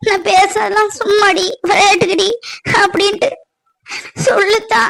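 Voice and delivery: weep it out, heartbroken; tearful crying daughter. Acted. Daughter weeps to her mother. (அழுதுகொண்டே உடைந்த குரலில் பேசும் மகள்)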